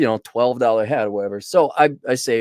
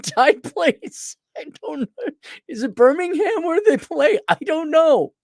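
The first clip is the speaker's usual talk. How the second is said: giggly; 14 semitones higher than usual. Real, not acted. Giggly confused rambling.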